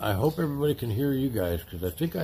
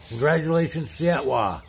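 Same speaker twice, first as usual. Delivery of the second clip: loud, higher excited congratulations; hyped giveaway announcement; 6 semitones higher, 6 dB louder than usual. Real, not acted. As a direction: announce the winner with big excitement.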